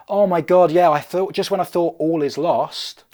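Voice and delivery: loud, high, excited pig; hammed-up elated pig; overjoyed relief. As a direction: burst out with acted relief. Acted pig rejoices at being rescued.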